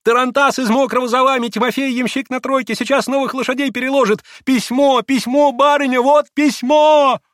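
very high, loud gabbled news; high-pitched, jubilant maid's cries; merry breathless exclaiming. A narrator's reading. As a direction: shout excitedly, peaking on the final word. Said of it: "восклицала она весело и запыхавшись".

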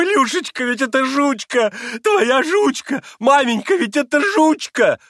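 very high, loud, stretched cries of bliss; blissful crying out. A narrator's reading. Captain seeing the lost dog, blissful high shouts. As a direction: shout the name in childlike rapture. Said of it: "выкрикивал он блаженным голосом".

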